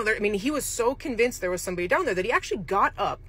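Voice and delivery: normal talking voice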